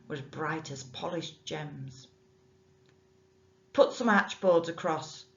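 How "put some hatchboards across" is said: louder barked order from the bargeman; a hint of a rough boatman giving orders. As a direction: snap the order at the boy. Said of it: "he ordered".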